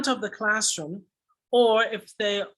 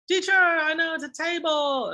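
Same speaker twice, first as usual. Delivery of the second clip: eager pupil; 10 semitones higher than usual. Retold or imitated. Teacher voices pupil answering.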